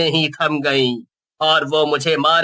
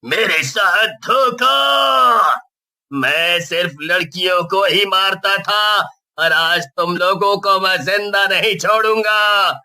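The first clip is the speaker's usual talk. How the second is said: ghost shouting; 4 semitones higher than usual. Acted. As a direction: roar it out like an angry ghost. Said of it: "जोर जोर से चिल्लाते हुए बोलता है".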